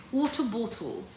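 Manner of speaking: British accent on water bottle. Shown as a demonstration. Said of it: "He specifically wants you to say water bottle".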